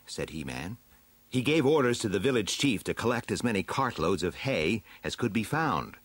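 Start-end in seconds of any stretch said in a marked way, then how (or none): none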